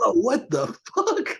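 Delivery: slow, high, laughing amazement; amazed whoa. Retold or imitated. Retelling watching the wind video, amazed and laughing. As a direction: stretch it out in stunned delight.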